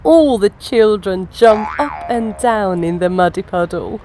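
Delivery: plain reading voice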